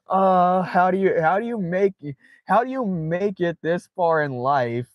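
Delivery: high and giggly, half laughing. Real, not acted. Streamer marvels at the photo to chat, laughing. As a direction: ask it while still cracking up.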